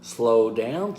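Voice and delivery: SLOW DOWN said low and measured; low, steady delivery of SLOW DOWN; slow down cadence. Shown as a demonstration. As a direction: keep SLOW DOWN low and even, rising slightly on down. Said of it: "you need to use your cadence".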